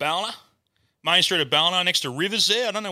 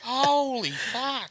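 amazed laugh; 10 semitones higher, much slower than usual. Real, not acted. Laughing disbelief.

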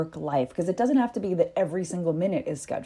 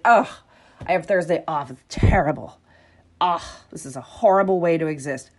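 hammed-up groaning whine, louder; mock complaining; 7 dB louder than usual. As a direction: moan about a day off as if it were torture. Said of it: "future me is gonna be like".

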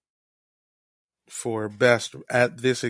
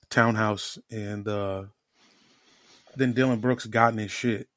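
ordinary delivery

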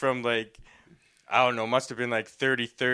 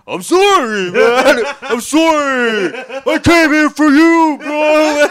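much higher, louder, slow pleading; loud drunken apology; 13 semitones higher, 15 dB louder, slower than usual. Retold or imitated. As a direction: wail the sorry like a drunk buddy. Drunk friend loudly apologizing.

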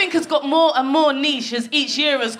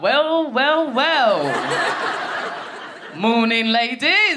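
smug greeting; much slower than usual. Retold or imitated. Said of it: "walked into the kitchen like".

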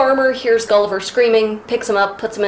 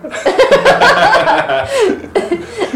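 high, loud laughing fit; burst of laughter; 12 semitones higher, 5 dB louder than usual. Real, not acted. Podcaster breaking up mid-quote, real laughter. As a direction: crack up helplessly.

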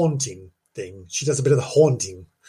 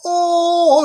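mock surprised oh; 5 dB louder than usual. Mock excited exclamation.

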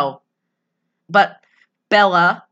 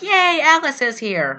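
excited cheer; 7 semitones higher than usual. Retold or imitated. Retelling their reaction to Alice, mock-excited cheer. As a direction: cheer like a delighted fan. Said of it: "we were like".

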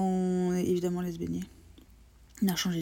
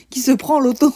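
much louder, higher comic exclamation; excited outburst; 6 semitones higher, 14 dB louder than usual. Retold or imitated. Telling how a guy hit the car, excited outburst. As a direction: burst out with the punchline.

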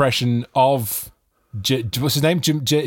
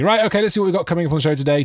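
quick, higher-pitched show opener; radio presenter; 6 semitones higher, much faster than usual. Retold or imitated. Presenter retelling his afternoon show opening, brisk and higher. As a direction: rattle it off like a presenter on air. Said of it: "I'd start talking".

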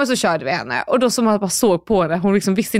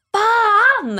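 much higher, drawn-out yell; retold angry scream; 13 semitones higher, 3 dB louder than usual. Woman who just missed the bus, screaming a curse. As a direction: imitate a furious shriek, stretching the word. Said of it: "verkligen så här skrek".

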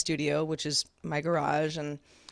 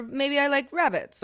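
higher-pitched mock rabbit fan; a hint of a put-on objector; other people; 7 semitones higher than usual. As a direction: lightly imitate someone demurring, pitched up. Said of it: "other people are like".

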